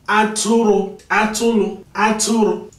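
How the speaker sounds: "Atụrụ" said high and crisp, each syllable separate, falling at the end; careful Igbo pronunciation. Shown as a demonstration. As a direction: say "Atụrụ" clearly for learners to repeat. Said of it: "pay more attention to the pronunciation in Igbo".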